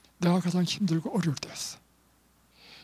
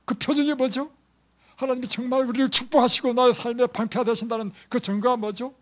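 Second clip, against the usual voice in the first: higher, louder demanding voice; insistent question; 7 semitones higher, 6 dB louder than usual. Acted. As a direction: press for proof. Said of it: "라고 물을 때".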